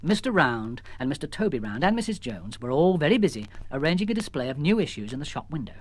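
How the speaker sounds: ordinary delivery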